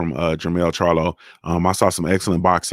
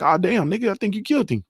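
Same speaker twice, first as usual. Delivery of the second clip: higher, offhand and casual; mock nonchalant trainer, pitched high; nonchalant imitation; 11 semitones higher, 3 dB quieter than usual. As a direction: toss it off like nothing happened. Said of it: "on some just nonchalant".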